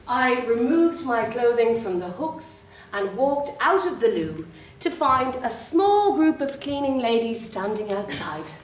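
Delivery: ordinary delivery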